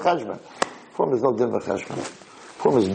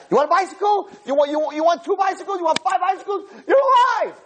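overjoyed shout. Acted. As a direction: burst out in giddy delight. God overjoyed giving bicycles.

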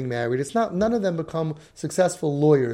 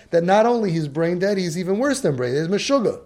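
ordinary talk

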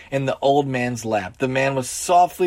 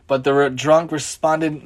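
usual speaking voice

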